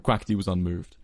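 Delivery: ordinary delivery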